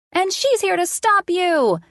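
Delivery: put-on high-pitched triumphant kid cry; little boy's shriek. A narrator's reading. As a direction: shriek it at a play villain. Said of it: "John shrieked".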